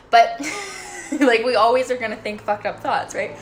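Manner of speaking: laughing off a dark confession. Real, not acted. Laughs at her own dark thought.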